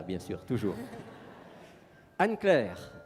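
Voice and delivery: ordinary delivery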